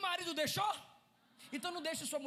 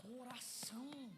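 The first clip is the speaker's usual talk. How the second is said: softer whispered word; 10 dB quieter, slower than usual. Acted. Preacher whispering the word to the congregation. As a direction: drop to a whisper for effect.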